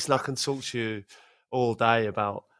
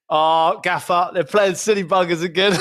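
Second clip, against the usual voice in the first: louder, laughing complaint to the gaffer; telling tales to the manager through laughter; mock-whiny assistant; imitating the assistant; 5 semitones higher, 5 dB louder than usual. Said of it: "the assistant goes".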